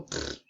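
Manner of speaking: soft puff of air through the lips; quick pfft. Retold or imitated. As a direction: blow a short dismissive pfft, like something dropping away. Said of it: "all those hormones go".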